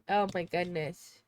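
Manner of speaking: ordinary talk, nothing marked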